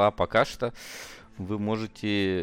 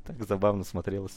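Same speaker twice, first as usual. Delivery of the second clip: ordinary talk, nothing marked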